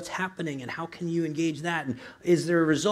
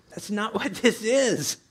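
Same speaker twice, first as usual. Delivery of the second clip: laughing through the words, a bit slow; a laugh breaks into the line; 6 semitones higher, 3 dB louder, slower than usual. Real, not acted. Pastor laughing at the congregation's reaction. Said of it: "Why are you laughing?".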